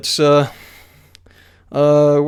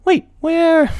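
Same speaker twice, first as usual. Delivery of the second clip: high, confused pony voice; puzzled pony heroine; 13 semitones higher than usual. A narrator's reading. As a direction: look around, lost. Twilight's voice.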